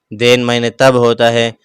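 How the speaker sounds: usual speaking voice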